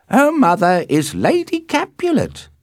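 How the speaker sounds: high, loud nurse; very high, emphatic informing; nurse's voice. A narrator's reading. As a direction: answer in a high, carrying servant voice. Nurse answers in character voice.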